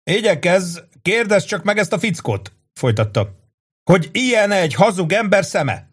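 rowdy barroom buddy; boisterous Angelo. A narrator's reading. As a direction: hail a friend across a packed bar. Angelo voiced.

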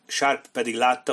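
usual speaking voice

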